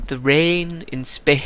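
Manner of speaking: slow, drawn-out posh English "the rain in Spain"; English accent. Retold or imitated. Gamer trying the line in a bad English accent, slow. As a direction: stretch "rain" in hammed-up English elocution. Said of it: "do a fucking English accent".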